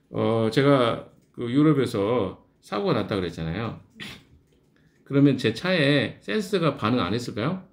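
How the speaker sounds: ordinary delivery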